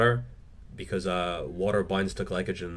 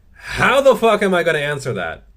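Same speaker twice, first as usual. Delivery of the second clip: loud, high, incredulous; exasperated outburst; 8 semitones higher, 8 dB louder than usual. Real, not acted. YouTuber reacting to an impossible viewer question, loudly exasperated. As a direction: throw up the question in comic exasperation.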